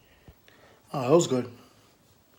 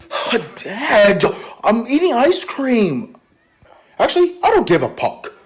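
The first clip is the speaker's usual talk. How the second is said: loud, very high whining yell; bratty outburst; 12 semitones higher, 7 dB louder, slower than usual. Acted. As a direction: shriek at Dad like a bratty kid. Acted son snaps at father.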